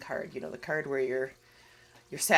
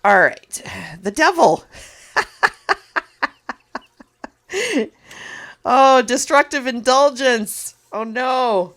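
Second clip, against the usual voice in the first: much louder and higher, dramatic gasp at the card; louder and higher, shocked at the devil card; dramatic reveal; 8 semitones higher, 13 dB louder, slower than usual. Real, not acted.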